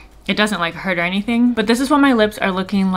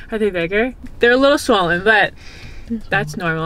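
ordinary talk

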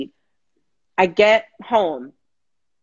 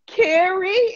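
much higher, strained cry of Carrie; desperate call; 13 semitones higher, 4 dB louder than usual. Retold or imitated. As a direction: call Carrie out in a cracked high plea. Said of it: "all I could get out was".

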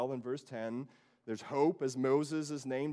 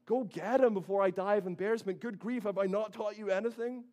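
mock exasperation, higher and louder; exasperated dad; 7 semitones higher, 4 dB louder than usual. Dad scolding daughters who forgot their manners, hammed-up exasperation. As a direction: throw up your hands like a fussing father. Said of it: "I can kind of picture Dad".

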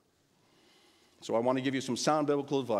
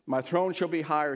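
raised royal proclamation; thundered; 5 semitones higher, 4 dB louder than usual. A narrator's reading. King demanding a higher throne, mildly thundered. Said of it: "his royal voice thundered".

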